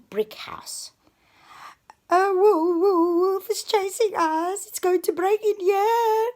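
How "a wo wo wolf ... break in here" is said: high, loud stammering fright; panicked pig. A narrator's reading. Pig crying out that the wolf is chasing them, high and loud. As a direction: stumble over the words in terror. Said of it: "cried Albert".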